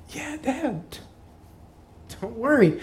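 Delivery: high, airy near-whisper; softly reassure, up in a child's pitch; small brave reassurance. Retold or imitated. Hurt boy reassuring his dad, high hushed voice.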